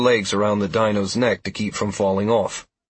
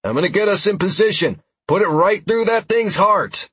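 shouting; 10 semitones higher, 3 dB louder than usual. A narrator's reading. Shouting orders mid chase.

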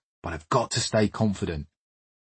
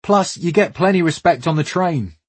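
put-on West African accent, higher and proud; boastful West African accent, pitched up; 8 semitones higher, 4 dB louder than usual. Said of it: "comes a thick West African accent".

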